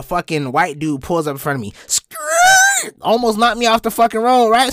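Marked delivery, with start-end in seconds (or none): 1.9-2.9 very high squealed car skid; imitated tire screech. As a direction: screech like braking tires. Imitates car pulling up sharply.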